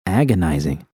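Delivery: plain reading voice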